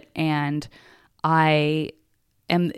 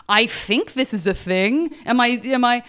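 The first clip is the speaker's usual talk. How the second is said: mock puzzled archaeologist; 5 semitones higher than usual. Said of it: "digging something up and going like".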